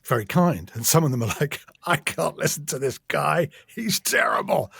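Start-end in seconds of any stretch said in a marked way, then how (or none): 1.9-4.7 higher-pitched mimicked complaint, drawn out at the end; mock outrage. Narrator mimicking a bad Audible review, mock outraged. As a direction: imitate an appalled critic, stretching out the verdict.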